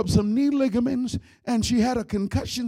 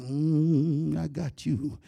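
sly snake voice; 7 semitones lower, much slower than usual. Acted. Snake voiced in comic story.

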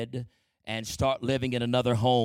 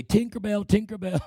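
higher sing-song taunt; 7 semitones higher than usual. Retold or imitated. Siblings mocking the boy, higher sing-song taunt. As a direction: tease like schoolkids. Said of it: "They say".

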